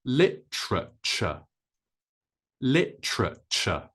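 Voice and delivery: literature as LIT-truh-chuh, three syllables; British pronunciation. Shown as a demonstration. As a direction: stress LIT in literature, crisp T, no final R. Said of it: "stress is on the first syllable".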